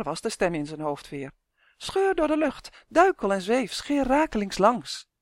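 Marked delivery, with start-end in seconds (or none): 1.8-5.1 urging voice. A narrator's reading. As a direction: stretch the words as if soaring. Voice in head urges flying. (hoog, gerekt en aansporend)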